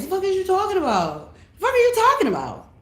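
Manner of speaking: high mock-pious version of her own line. Shown as a demonstration.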